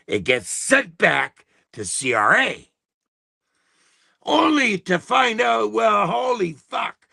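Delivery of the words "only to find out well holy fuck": exasperated shout. Real, not acted. Ranting about the tax office, exasperated shout. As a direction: blurt it out in fed-up outrage.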